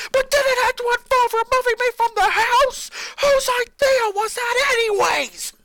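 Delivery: very high, loud shout; boy shouting at his family; furious demand. Acted. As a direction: demand an answer, yelling. Lincoln demands answer.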